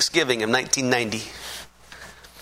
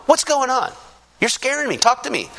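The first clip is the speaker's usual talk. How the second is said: a touch of mock fear, higher; scared mother; 8 semitones higher than usual. Frightened mother questions her son, mild mock fear. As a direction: imitate a worried mom asking what's wrong. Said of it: "like, scared".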